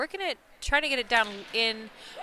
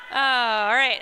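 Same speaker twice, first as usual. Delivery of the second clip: usual speaking voice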